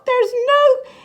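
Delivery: loud, happy cry; excited relief. Acted. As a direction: shout it with joyful relief.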